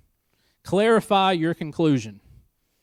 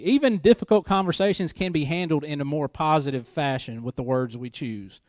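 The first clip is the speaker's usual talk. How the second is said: ordinary delivery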